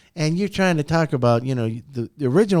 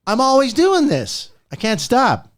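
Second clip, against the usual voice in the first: pitched up, lively and bouncing; animated; 7 semitones higher than usual. Shown as a demonstration. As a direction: show how animated you always are. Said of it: "You'll see me".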